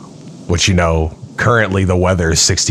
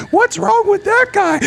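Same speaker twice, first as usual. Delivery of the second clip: lady bystander. Pretend. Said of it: "this lady next to him was just like".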